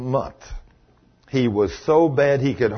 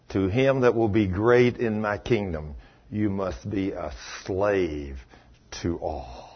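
hushed solemn; 7 semitones lower, 7 dB quieter than usual. Retold or imitated. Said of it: "He said".

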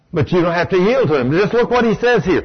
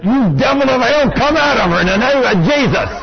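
higher, forceful casting-out; commanding rebuke; 6 semitones higher than usual. Retold or imitated. Preacher rebuking the devil in his wife, forceful. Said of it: "they'd have thought I'd have lost it".

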